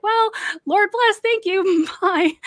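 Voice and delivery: high, singsong mock politeness; forced cheery farewell. Young woman grabbing her bag after prayer, forcedly cheery. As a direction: chirp it brightly to escape an awkward moment.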